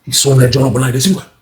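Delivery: ordinary delivery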